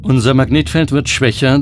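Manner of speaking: ordinary talk, nothing marked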